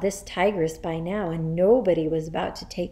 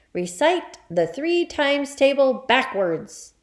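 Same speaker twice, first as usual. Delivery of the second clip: higher, sharply barked command; barked order; 6 semitones higher, 4 dB louder than usual. Acted. Headmistress tests a terrified boy, barking. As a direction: bark it like a drill order. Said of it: "the Trunchbull barked".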